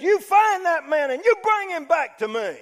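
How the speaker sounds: a furious king ordering a manhunt; berserk king. Retold or imitated. Preacher voicing furious King David ordering Nathan's man found, enraged shout. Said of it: "I mean, he goes berserk".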